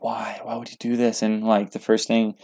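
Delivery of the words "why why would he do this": soft, bewildered questioning; hushed disbelief; stunned inner thought. Retold or imitated. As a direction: ask it quietly, to yourself. Retold inner thought while restraining dad.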